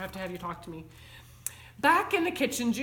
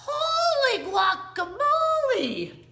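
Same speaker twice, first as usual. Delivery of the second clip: very high, loud, stretched-out cry; astonished exclamation; 6 dB louder, slower than usual. A narrator's reading. Kitten passes his bedroom mirror, astonished exclamation. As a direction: exclaim it in drawn-out amazement. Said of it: "he exclaimed".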